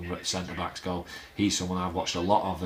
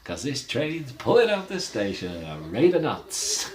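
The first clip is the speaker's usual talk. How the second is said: rising, louder rallying cry; excited hype; 6 semitones higher, 5 dB louder than usual. Real, not acted. Fan telling viewers the season will take off, excited. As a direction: get the fans fired up.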